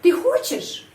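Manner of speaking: хочешь jumps high on its first syllable; sharp questioning peak on хочешь; question intonation. Shown as a demonstration. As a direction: ask it by a high lift on хочешь. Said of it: "saya menyusun kalimat tanya".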